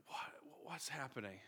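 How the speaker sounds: hushed whisper. Retold or imitated. Father hearing footsteps after bedtime, whispering. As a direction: whisper so you don't wake anyone.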